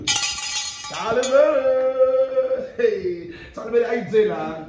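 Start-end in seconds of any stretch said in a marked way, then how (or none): 0.1-2.7 long drawn-out name call. Shown as a demonstration. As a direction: stretch "Charlie Berry" out very slowly, higher, like announcing a star. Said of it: "name repeated drawn out".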